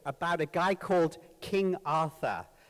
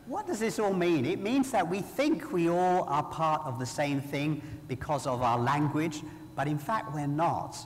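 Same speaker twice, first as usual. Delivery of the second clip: ordinary talk, nothing marked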